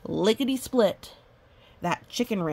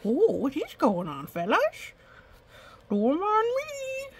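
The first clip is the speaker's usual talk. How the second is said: little scare creature; 10 semitones higher, slower than usual. Pretend. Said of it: "the scare is just like".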